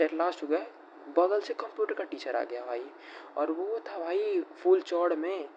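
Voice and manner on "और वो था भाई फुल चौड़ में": ordinary talk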